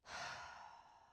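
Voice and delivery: long open-mouthed breathy sigh; dramatic sigh. Shown as a demonstration. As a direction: let out a big audible sigh. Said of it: "start to do a couple of sighs with your mouth open".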